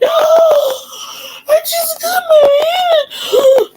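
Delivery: high, screamed, drawn-out wail; hammed-up crying meltdown; mock hysterics. Re-enacting delayed panic after stitches.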